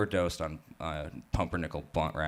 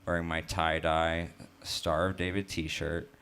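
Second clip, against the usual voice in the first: usual speaking voice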